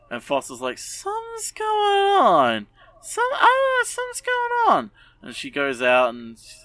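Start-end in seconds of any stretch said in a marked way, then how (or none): 0.8-4.9 mock suspicious squeak. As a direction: squeak it out like a paranoid cartoon. Foss suspicious, high far voice.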